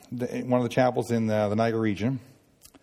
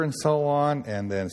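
ordinary talk, nothing marked

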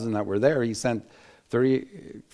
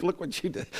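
mock scolding; 7 semitones higher, 6 dB quieter than usual.

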